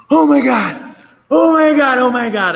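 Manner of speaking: high, loud mock panic; panicked freakout. Old self panicking at a coworker, mock hysterical shrieking. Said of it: "walking into somebody at work just like".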